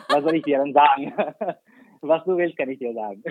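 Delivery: frases en alemán con risa contenida; hablando alemán entre risas. Real, not acted. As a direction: suelta el alemán riéndose, a pedido. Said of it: "me tienes que decir algo en alemán". (entre risas, le habla en alemán porque se lo pidieron)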